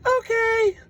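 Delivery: very high squeaked okay; squeaky clerk okay. Retold or imitated. Alien clerk accepting the bill, very high. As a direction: chirp a tiny okay. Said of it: "she's like".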